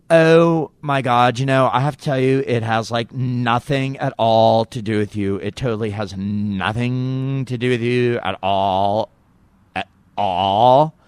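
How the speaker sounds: exaggerated, drawn-out young woman's speech; Monica Lewinsky. Monica tells host it's not about him, drawn-out mock voice. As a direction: stretch the key words in a put-on girly drawl. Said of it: "she doesn't talk like that".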